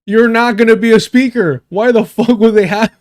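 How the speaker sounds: loud, high incredulous objection; exasperated outburst. Real, not acted. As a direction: cut in loudly, exasperated. Exasperated outburst at clip's complaint.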